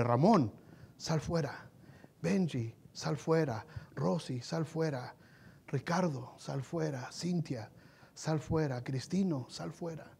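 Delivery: hushed calls of each name, low and soft; soft calling. Acted. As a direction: call them out gently, softly. Jesús llama a los muertos. (llamado suave y bajo)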